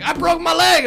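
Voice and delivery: high, loud cry from the injured friend. Retold or imitated. Injured friend telling his buddies at the hill, loud high cry. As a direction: wail it like a hurt teenager. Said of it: "And he's like".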